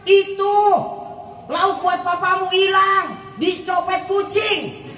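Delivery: high, slow, stretched-out complaint; mock wailing mother. Mother lying to child that cat took papa's fish, mock dismay. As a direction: act out a mother's exaggerated dismay.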